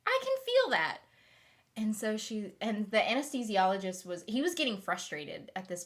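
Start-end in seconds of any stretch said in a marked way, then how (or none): 0.1-1.0 squeaky high-pitched voice, a little louder; high squeak of discomfort; high squeaky yes. Retold or imitated. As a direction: squeak it out like a startled patient. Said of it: "I was like".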